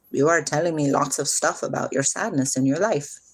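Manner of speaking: ordinary talk, nothing marked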